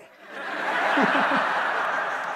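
high, loud burst of laughter; real long laugh. Real, not acted. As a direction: crack up at the cold reply. Laughs at Andre's reply.

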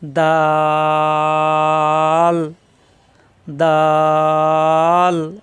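দাল with a very long level aa before the l; elongated letter. Shown as a demonstration. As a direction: hold দাল on one steady note for seconds. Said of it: "এখানে কিন্তু টান দেওয়া যায়". (অনেক লম্বা টান দিয়ে দাল)

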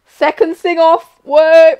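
loud, slow announcement; hype. Real, not acted. Streamer announcing the second sing-off, loud.

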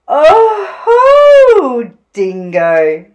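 high, loud swooping hoot that drops away; theatrical emu lady; fluttery Emu. A narrator's reading. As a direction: flutter a grand greeting. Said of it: "she fluttered".

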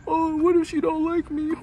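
put-on high, slow whimpering parody of a sad boy; mock the guy who cries over a girl; squeaky high mocking sob voice; mock whiny lovesick guy. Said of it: "cry talking about some".

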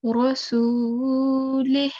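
rasulih ending with all the air let out on "h"; slow recited rasulih, audible "h" at the close; clear final ha. Shown as a demonstration. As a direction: stretch "suu", then exhale a clear "h". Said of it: "let all the air out".

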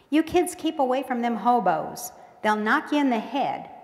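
loud warning call from afar; mama shouts. A narrator's reading. Mama shouting after the kids, loud warning. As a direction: yell it after the running kids. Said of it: "Mama shouts to our backs".

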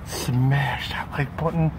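slow breathy whisper. Real, not acted. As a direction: whisper it slowly. Hiding, outro plea.

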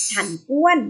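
ordinary delivery

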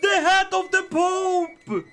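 high, slow drawn-out crow; savoring the win loudly; slow gloating shout. Real, not acted. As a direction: stretch HAT out in triumph. Repeat drawn out.

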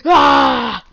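short loud yell; battle yell. Pretend. Gamer attacks a creeper, loud yell. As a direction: yell out as you strike.

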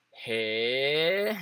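long drawn-out "Hey" sliding upward; "Hey" as a drawn-out questioning rise; rising intonation. Shown as a demonstration. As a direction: stretch "Hey" and swell into a rise at the end. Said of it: "the intonation can go up".

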